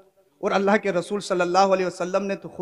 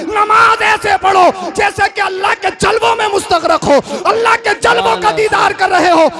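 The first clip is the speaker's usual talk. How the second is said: full-force preaching; 6 semitones higher, 7 dB louder than usual. Acted. Preacher urging the congregation about prayer, shouting at full force. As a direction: thunder it out to the crowd like a fiery preacher.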